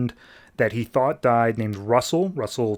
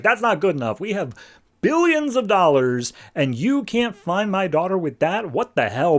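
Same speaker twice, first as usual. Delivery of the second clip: higher, pushy rant at Batman; angry dad demanding answers, pitched up; a father ranting at Batman about his daughter, voice raised high; 7 semitones higher than usual. Retold or imitated. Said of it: "he just starts ranting".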